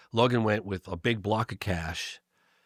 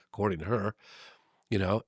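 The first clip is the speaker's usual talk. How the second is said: ordinary delivery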